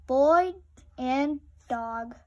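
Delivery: loud. A narrator's reading. Boy howling with his dog, loud burst. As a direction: belt the word out.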